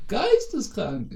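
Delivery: high, very slow squeal; drawn-out squeal. Retold or imitated. Mocking gushy friends, stretching it high and slow.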